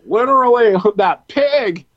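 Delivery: high, loud squealing laugh; excited shriek. Acted. Mocking pig joke, shrieked.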